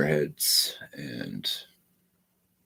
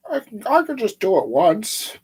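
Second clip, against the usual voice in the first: high, loud voice of craving; mock the voice that says just once; tempting inner voice; 6 dB louder than usual. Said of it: "all the times they said that".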